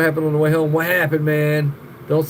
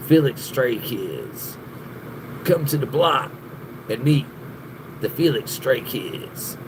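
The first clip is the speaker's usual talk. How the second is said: slow, softer, drawn-out show announcement; mock TV announcer; 4 semitones lower, 5 dB quieter, slower than usual. Pitching a pretend TV show about a viewer, slow announcer. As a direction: say it like a low-key TV promo. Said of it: "should be like a T V show".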